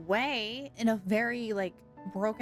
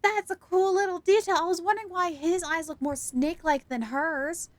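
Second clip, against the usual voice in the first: higher, louder, delighted discovery; excited reaction; 7 semitones higher, 6 dB louder than usual. Real, not acted. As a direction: blurt it out, thrilled by the detail. Streamer delighted by game detail.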